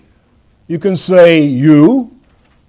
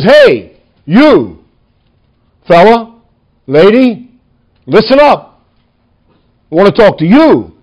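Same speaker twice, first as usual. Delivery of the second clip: punchy, separate call-outs with pauses; calling out individuals. Acted. Paul stopping to address fella, lady, calling them out. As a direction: buttonhole one listener after another. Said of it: "he pauses in his large, sweeping salvation history mode and says".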